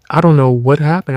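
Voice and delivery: ordinary talk, nothing marked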